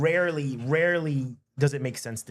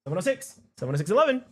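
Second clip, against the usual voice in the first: ordinary delivery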